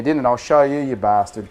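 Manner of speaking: usual speaking voice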